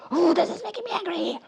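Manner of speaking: squeaky high-pitched mock anger. Telling the caller she's angry, in a squeaky playful voice. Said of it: "It's just like".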